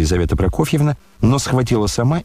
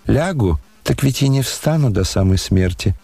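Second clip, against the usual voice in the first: usual speaking voice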